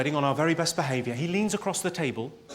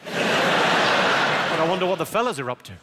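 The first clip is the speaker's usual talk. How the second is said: mock Geordie; 4 dB louder, slower than usual. Geordie voice musing about fellas.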